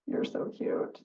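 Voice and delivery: breathy whispered compliment. Acted. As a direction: lean in and whisper it. Fox gushes at rabbit.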